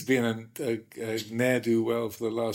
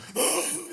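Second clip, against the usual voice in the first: high, choked gasp for air; imitating someone winded under a stick; mock gasp. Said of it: "Warapanyo's kind of gasping for breath".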